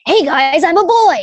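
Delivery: put-on generic shonen boy character; anime boy voice. Shown as a demonstration. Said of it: "that generic, uh, anime boy voice where it's like".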